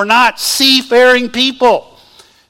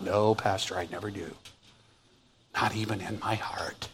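hushed, fading pretend denial; mock pious whisper; 13 semitones lower, 18 dB quieter than usual. A pretend churchgoer answering the pastor, dropping to a whisper. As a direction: whisper it like a too-holy churchgoer. Mock congregant denies such talks.

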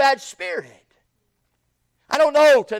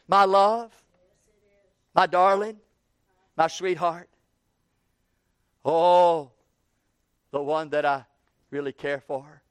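fading, much softer loving endearments; soft and tender; 5 semitones lower than usual. Acted. Shepherd softly calling his beloved, tender and fading. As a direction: melt into a whisper of love. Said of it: "he calls her softly".

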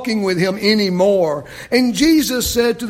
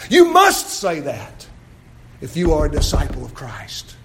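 usual speaking voice

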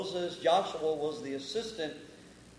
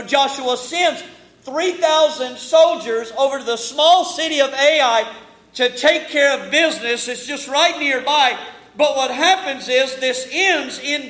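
raised, driving sermon delivery; emphatic pulpit storytelling that builds; forceful preaching; 5 semitones higher, 9 dB louder than usual. Real, not acted. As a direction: preach it out to the congregation, building in volume and pitch.

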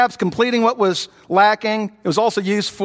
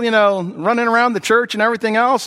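usual speaking voice

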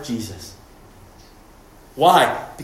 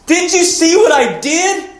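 slow, high, protesting plea; pleading; 6 dB louder than usual. Retold or imitated. The many pleading with Jesus, high and protesting. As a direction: beg to be recognised.

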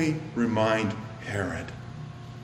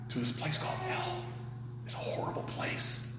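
hushed warning; 13 dB quieter than usual. Real, not acted. Preacher warning of hell.

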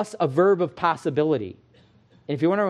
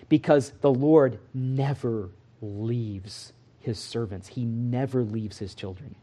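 reassuring; 3 semitones lower, slower than usual. Real, not acted. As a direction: lay each word down gently. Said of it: "slow assurance after lies".

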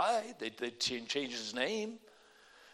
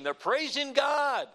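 preaching at full force; 7 semitones higher, 5 dB louder than usual. Real, not acted.

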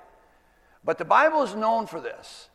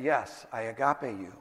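hushed Peter; 7 semitones lower, 7 dB quieter than usual. Acted. Peter can't bring himself to say it.

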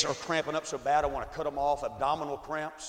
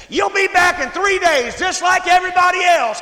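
mocking shout; 16 dB louder than usual. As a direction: jeer it out like you're sure he'll fail. Doctor mocking him, chair kicked back.

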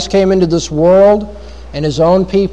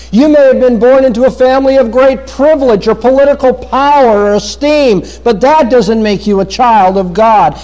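higher-pitched, insistent pulpit delivery; forceful preaching; 7 semitones higher than usual. Real, not acted. Preacher telling listeners birth cannot make them God's children, forceful preaching. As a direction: press each point home to the congregation, pitch held high.

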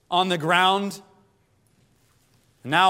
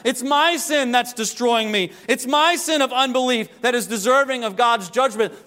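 higher, louder, driving preacher's cry; full-force preaching; 8 semitones higher, 5 dB louder than usual. Acted. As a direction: hammer it home from the pulpit.

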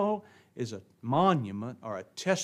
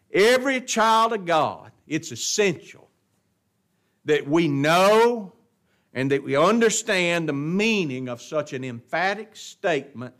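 raised voice, key words drawn out; forceful preaching; 4 semitones higher, 7 dB louder than usual. Real, not acted. Preacher telling every child of God what is essential, forceful preaching. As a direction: proclaim it, leaning long on the big words. Preacher drives home his point.

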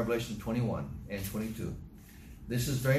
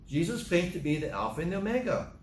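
ordinary talk

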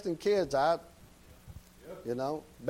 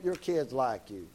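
tender emphasis; 5 semitones lower than usual. Shown as a demonstration. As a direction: lean in and say it gently, slowly. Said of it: "Brother Clint".

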